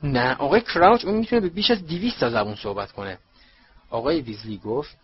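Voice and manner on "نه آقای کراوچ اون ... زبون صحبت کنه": loud, higher-pitched eager correction; carried away with excitement; very excited. A narrator's reading. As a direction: burst in excitedly, gushing with admiration. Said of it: "پرسی از شدت هیجان".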